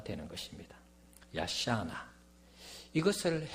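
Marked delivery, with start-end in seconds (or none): 1.3-2.1 야샤나 sounded out as ya-sha-na with drawn vowels; Hebrew pronunciation. Shown as a demonstration. Preacher joining two Hebrew words for the congregation, sounding out 야샤나. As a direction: say 야샤나 syllable by syllable. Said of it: "히브리어로는".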